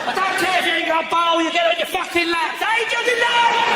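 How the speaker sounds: shouting out servings to a crowd; bellowed vendor call. Acted. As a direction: order them, then holler the dessert. Acts usher handing out dessert.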